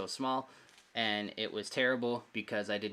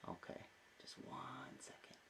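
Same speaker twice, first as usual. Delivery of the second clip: hushed whisper to his son; 3 semitones higher, 20 dB quieter than usual. Real, not acted. Hushing child mid-livestream.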